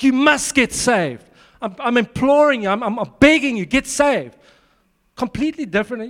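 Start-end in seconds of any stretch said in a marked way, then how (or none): 0.0-4.4 fervent plea, voice lifted; urgent pleading. Retold or imitated. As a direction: beg them to get saved. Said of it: "There's an urgency".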